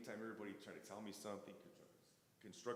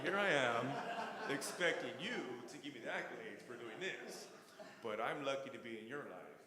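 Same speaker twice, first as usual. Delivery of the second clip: louder, emphatic self-correction; a louder, emotional confession; emotional realization; 4 semitones higher, 9 dB louder than usual. Retold or imitated. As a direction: declare it like a revelation. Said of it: "I was like".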